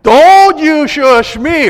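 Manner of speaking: the wife bristling at being shushed; loud, slow, high-pitched don't-you-dare; indignant wife. Retold or imitated. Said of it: "I tried to shush my wife once".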